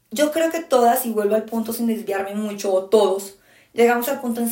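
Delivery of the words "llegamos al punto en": normal talking voice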